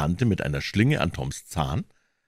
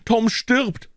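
put-on very high, panicked boy's cry; urgent high-pitched call for help; breathless calling; 13 semitones higher than usual. A narrator's reading. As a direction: raise the alarm in a rush. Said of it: "rief atemlos".

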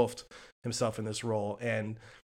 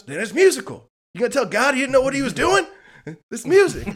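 very high, shouted impression of a bandleader; 13 semitones higher, 11 dB louder than usual. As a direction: belt it out in a put-on high voice, preaching the point. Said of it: "[name]'s telling him".

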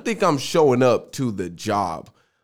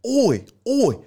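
high, exaggerated Australian warm-up; hammed-up attempt at an Australian accent; bad Australian accent; 6 semitones higher, slower than usual. Shown as a demonstration. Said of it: "can't do my Australian accent".

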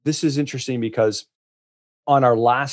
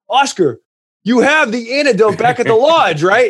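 higher and louder, urgently asking again; alarmed repeat; 11 semitones higher, 6 dB louder than usual. Retold or imitated. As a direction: push the question up in pitch and volume. Panicked repeat about the antidote.

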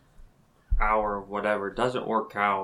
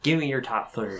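put-on southern accent; 3 semitones higher, 5 dB louder than usual. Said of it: "My southern accent".

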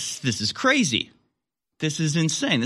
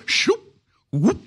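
high squeaky whoosh noise; tube whoosh; 11 semitones higher than usual. Retold or imitated. Migrants sucked up the tube, high squeaky whoosh. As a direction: zip it up like a vacuum tube.

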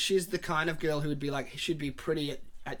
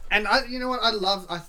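excited; 7 semitones higher, 7 dB louder than usual. Real, not acted. Excited outburst about scene.

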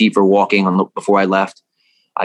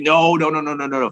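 retold bawling; 5 semitones higher than usual. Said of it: "I start bawling".

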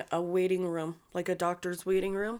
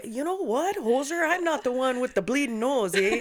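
put-on higher Canadian accent, movie quote; Canadian movie quote, pitched up; 6 semitones higher than usual. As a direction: do the Strange Brew Canadian line. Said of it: "that's my favorite scene".

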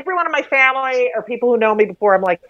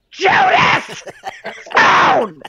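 much higher, louder mock yell up the stairs; mother's yell; 10 semitones higher, much slower than usual. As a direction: belt the name like a mom calling upstairs. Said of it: "as loud as possible into the phone".

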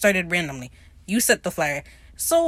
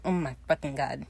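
muttered disbelief; 6 semitones lower, 8 dB quieter than usual. Retold or imitated. Steed's face reacting to Jack.

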